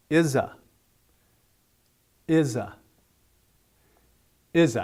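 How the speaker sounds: "Izza" with a clear buzzing Z; teacher's careful "Izza", z fully voiced; voiced Z sound. Shown as a demonstration. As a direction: model "Izza" as one word, voiced z, falling tune. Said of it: "hear the Z sound on Izza".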